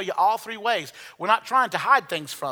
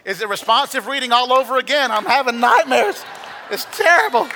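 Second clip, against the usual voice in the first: loud, high joking complaint; hammed-up nightmare lament; mock dismay; 4 semitones higher, 7 dB louder than usual. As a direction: play up the horror for laughs. Mock lament about bad recitation.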